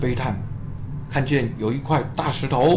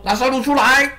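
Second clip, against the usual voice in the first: higher, louder call into the tomb; a loud, commanding summons; 12 semitones higher, 4 dB louder than usual. Acted. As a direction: call Lazarus out with authority. Jesus calls into tomb.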